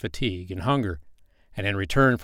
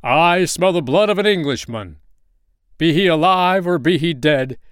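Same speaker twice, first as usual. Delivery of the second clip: booming giant's roar, higher and louder; giant's bellow; 7 semitones higher, 7 dB louder than usual. Acted. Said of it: "he bellowed as he came".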